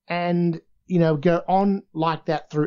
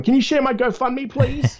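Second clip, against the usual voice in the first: mock pleading tweet; 12 semitones higher, 4 dB louder than usual. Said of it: "I was just tweeting everyone".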